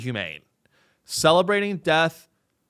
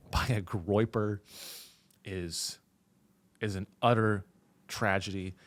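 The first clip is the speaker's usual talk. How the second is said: mock solemn statement; 6 semitones lower, 5 dB quieter than usual. Commentator reading out a mock condolence statement, mock solemn. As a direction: deliver a hushed, faux-grave public condolence.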